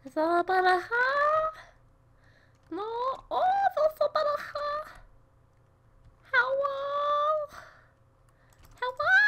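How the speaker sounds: very high, playful squeaky voice; silly falsetto, far above normal. Pretend. Playing with squeaky voice.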